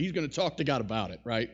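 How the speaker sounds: ordinary talk, nothing marked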